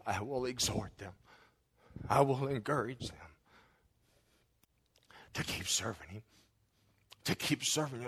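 spoken prayer that swells to a pleading climax, then drops to a hush; preacher praying over his church; fervent prayer. Acted. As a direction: pray aloud with rising fervour, then soften. Pastor prays aloud before congregation.

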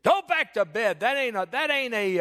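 high, loud mock scolding; unsympathetic grandpa. Grandpa sending grandchild back to bed, acted loud scolding. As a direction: order the kid off crossly. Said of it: "very comforting people. Not".

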